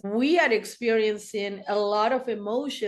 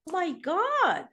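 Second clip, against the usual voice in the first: high, stunned "oh my God"; shocked gasp; 6 semitones higher than usual. Retold or imitated. Seeing the abuse cycles on paper, high shocked exclamation. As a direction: jaw dropping in disbelief. Reading therapist's marked cycles.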